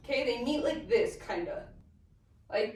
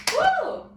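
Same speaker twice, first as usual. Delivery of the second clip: a high, loud whoop of joy; excited woo; 14 semitones higher, 4 dB louder than usual. Real, not acted. Dance teacher cheering after finishing the count, excited whoop.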